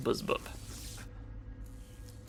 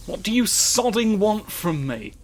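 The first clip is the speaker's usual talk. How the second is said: loud, higher, fed-up snap at the game; exasperated outburst; 7 semitones higher, 6 dB louder than usual. Real, not acted. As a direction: let the frustration burst out.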